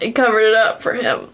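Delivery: normal talking voice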